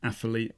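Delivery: "Athlete" said as "Afalete", f for th; "Athlete" with th turned into f and an extra vowel. Shown as a demonstration. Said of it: "it's terrible".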